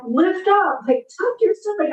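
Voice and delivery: high-pitched mock coach call; imitating a gym coach's bright cue; coach's instructions. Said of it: "They kept being like".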